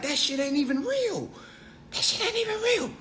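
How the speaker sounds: high soft scoffing; mocking disbelief. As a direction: scoff at the fantasy. Mocking naive buyer's belief.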